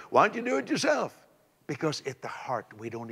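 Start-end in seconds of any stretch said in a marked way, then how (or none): 0.1-1.1 high challenging question. Real, not acted. Challenging the congregation.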